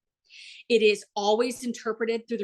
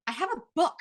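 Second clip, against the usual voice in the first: "book" punched after a higher run-up; stress on BOOK; 8 semitones higher, faster than usual. Shown as a demonstration. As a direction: land hard on the word "book". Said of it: "the way I said those sentences".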